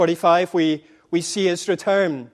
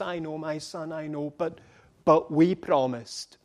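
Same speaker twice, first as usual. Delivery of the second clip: much softer, tender and low; a father's soft reply to his grieving son; hushed father; 4 semitones lower, 8 dB quieter than usual. Acted. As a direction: say it gently, almost under the breath. Father answering the son gently.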